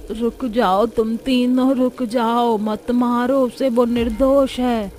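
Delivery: high, slow, drawn-out plea; elderly woman's long-drawn entreaty; pleading old woman. Acted. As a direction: beg them to stop, stretching each phrase.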